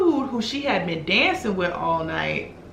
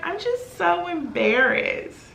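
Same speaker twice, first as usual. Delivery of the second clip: high, drawn-out imitation of a crying friend; mock tearful; 10 semitones higher, slower than usual. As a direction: whimper it slowly, poking fun at her. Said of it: "Why are you crying?".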